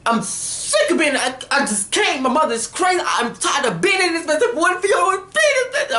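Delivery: high-pitched, whiny frantic venting; going in, a hammed-up meltdown; hysterical rant. Recapper imitates Jeffrey ranting about being in this mess, hysterical. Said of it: "I'm just going in".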